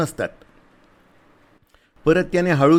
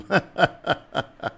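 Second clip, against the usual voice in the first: soldier's laugh; 8 semitones higher than usual. A narrator's reading. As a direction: laugh at the girl's answer. Soldier laughs at her answer.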